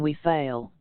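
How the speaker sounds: quick scornful "we fail?" asked in disbelief; contemptuous question. Shown as a demonstration. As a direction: sneer it as a short mocking question. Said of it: "At first a quick contemptuous interrogation".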